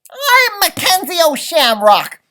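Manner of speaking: high, loud indignant shout. A narrator's reading. Leprechaun shouting his name at the dog. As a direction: announce your name in a fury. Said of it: "I shouted".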